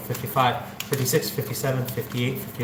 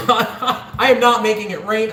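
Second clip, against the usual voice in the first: laughing; 7 semitones higher than usual. Real, not acted. Laughing while stacking cash.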